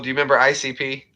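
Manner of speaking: ordinary delivery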